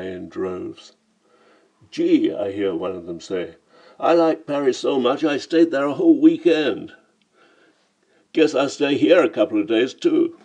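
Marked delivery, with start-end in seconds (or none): 1.9-2.3 loud, high American tourist; caricatured American twang; jarring American accent. A narrator's reading. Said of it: "the jarring accents of American tourists". 4.0-10.4 loud, high American tourist; caricatured American accent, raised and insistent; jarring American accent. A narrator's reading. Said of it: "listening to the jarring accents of American tourists".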